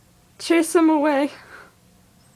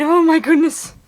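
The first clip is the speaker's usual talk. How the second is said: startled; 4 semitones higher, 10 dB louder than usual. Real, not acted. Startled by the birds.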